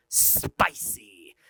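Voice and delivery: SPICY very low and stretched. Shown as a demonstration. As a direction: drop deep and draw out "spicy". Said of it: "having a little bit of spicy".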